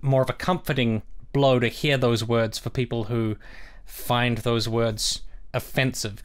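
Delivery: normal talking voice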